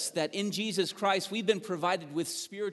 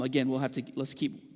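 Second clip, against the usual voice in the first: ordinary talk, nothing marked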